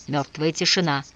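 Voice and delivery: ordinary delivery, nothing marked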